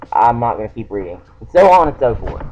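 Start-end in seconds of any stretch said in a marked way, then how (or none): none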